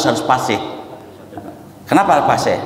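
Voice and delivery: ordinary talk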